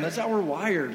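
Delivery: ordinary delivery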